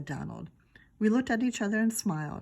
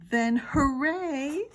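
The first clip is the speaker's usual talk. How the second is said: excited hooray; 7 semitones higher, 5 dB louder, much slower than usual. Shown as a demonstration. Misread then re-read line.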